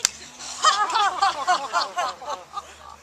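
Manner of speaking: mocking royal laugh; scornful laugh; king laughing. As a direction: laugh him off. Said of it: "He laughed".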